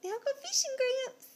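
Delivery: put-on lifted high for the toddler to copy; high sing-song prompt. Shown as a demonstration. Mother coaxing toddler to repeat, high sing-song. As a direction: coax a little one to repeat it, high and bright.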